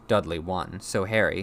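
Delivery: plain reading voice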